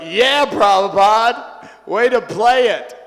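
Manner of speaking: loud, drawn-out acted cheer. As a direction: whoop it like fans applauding a clever move. Devotees cheering their teacher on.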